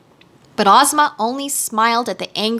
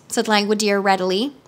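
plain reading voice

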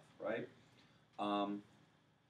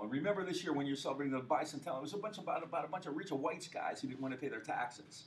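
quoting the hippie teacher, pitched up and quicker; hippie teacher quote; 6 semitones higher, 4 dB louder than usual. Retold or imitated. Lecturer mimics film's hippie teacher addressing class, raised and quick. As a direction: play the hippie professor's parting line, raised and fast. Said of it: "she says something like".

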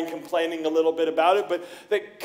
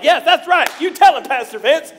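loud, high cheer from the crowd; hammed-up amen-corner shout; mock cheering; 7 semitones higher, 9 dB louder than usual. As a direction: cheer him on like a fired-up churchgoer. Imitating cheering congregation members.

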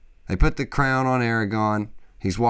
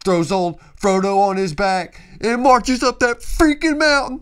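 rising higher and louder to a yell; building excitement; 11 semitones higher, 7 dB louder than usual. Real, not acted. Fan retelling the climb, building to a shout.

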